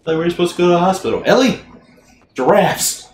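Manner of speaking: calling out loudly; excited shouting. Pretend. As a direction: yell it with glee.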